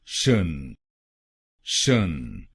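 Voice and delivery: शन said as "shun", falling low; syllable demo. Shown as a demonstration. Teacher isolating the last syllable, low careful pronunciation. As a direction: let शन drop low, a clipped "shun". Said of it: "टीआईओएन को बोलिए".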